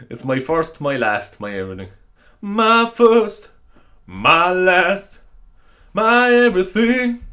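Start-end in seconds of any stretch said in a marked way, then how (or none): 2.4-7.2 high, slow crooning put-on voice. Podcaster sings the line to his listeners, hammed-up crooner. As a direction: croon it slowly like a hammed-up soul singer.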